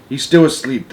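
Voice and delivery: ordinary delivery, nothing marked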